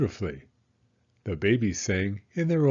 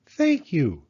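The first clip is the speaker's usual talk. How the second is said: put-on higher, polite child voice; a proud little girl thanking; little girl Penny; 6 semitones higher, 4 dB louder than usual. Penny thanks her parents, lightly childlike.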